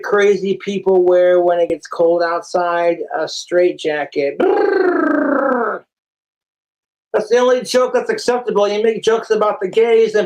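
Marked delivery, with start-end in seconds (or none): 4.4-5.8 high mock shivering brrr; high shivery brrr; shiver noise.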